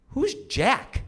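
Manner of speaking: high little-girl voice, imitated; puzzled mock-child pipe-up; daughter's question. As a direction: ask innocently in a high child voice. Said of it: "my daughter goes".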